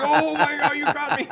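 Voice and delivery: high, loud laughing "you got me"; laughing disbelief. Retold or imitated. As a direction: laugh it out in shock. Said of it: "I was like".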